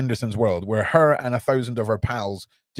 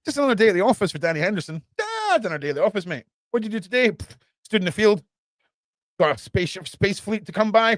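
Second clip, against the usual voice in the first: mock casual Danny; 5 semitones higher, faster than usual. Parodying Danny's casual attitude.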